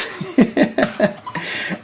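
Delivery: light, airy laugh; breathy, unvoiced chuckle; amused laugh. Real, not acted. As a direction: laugh at your own remark. Laughs at the swollen peas.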